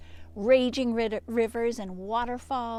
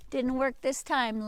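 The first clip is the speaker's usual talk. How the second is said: usual speaking voice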